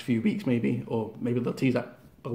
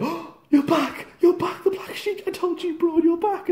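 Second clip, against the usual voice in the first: gushing Bray. Retold or imitated. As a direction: gush over a returning friend.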